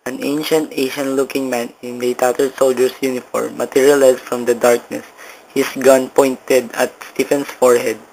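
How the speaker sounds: plain reading voice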